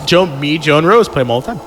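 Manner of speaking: normal talking voice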